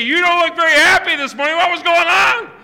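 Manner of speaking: fast, rising into a loud mock cry; mock grandkid question. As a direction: tease him like a puzzled grandchild. Grandchildren questioning old photo.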